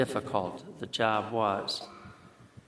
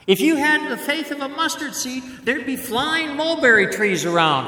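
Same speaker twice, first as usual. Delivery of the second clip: teasing Jesus voice; 14 semitones higher, 7 dB louder than usual. Retold or imitated. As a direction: rib them with a grin.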